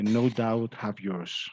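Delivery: ordinary talk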